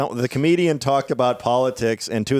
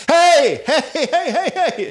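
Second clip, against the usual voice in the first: loud, high hollering of hey; retold yelling; 4 dB louder, slower than usual. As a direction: mimic a woman barging in and yelling hey. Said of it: "and she runs in and goes".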